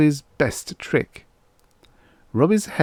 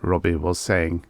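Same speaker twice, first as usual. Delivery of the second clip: ordinary delivery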